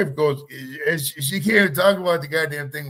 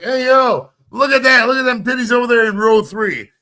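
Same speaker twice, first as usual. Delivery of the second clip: loud, high, gleeful call-out to a buddy; hollering about the crowd, hammed up; excited leering shout; 8 semitones higher, 8 dB louder than usual. Wrestler nudging his partner mid-match, loud leering call-out.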